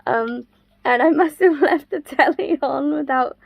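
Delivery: usual speaking voice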